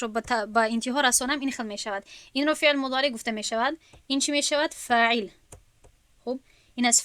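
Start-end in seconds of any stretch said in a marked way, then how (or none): none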